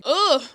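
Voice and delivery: frustrated ugh, loud; annoyed groan. Real, not acted. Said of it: "I don't know why I sound like this".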